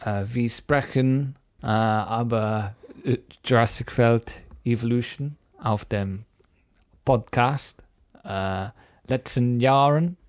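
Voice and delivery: hammed-up German accent; mock German. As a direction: fake German with lots of äh. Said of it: "like a stereotypical Dutch person".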